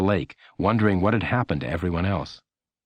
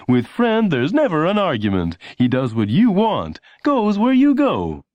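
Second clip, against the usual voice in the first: high, louder, slow ad read; announcer voice; 10 semitones higher, 5 dB louder than usual. A narrator's reading. Announcer reminding Lenny before the empty house, salesy. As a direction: pitch it like a TV announcer. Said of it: "the announcer would say".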